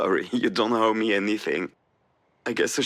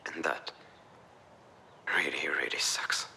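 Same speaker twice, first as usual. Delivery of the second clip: hushed despair; 4 semitones lower, 6 dB quieter than usual. Acted. Alex breaks down at the Lookout.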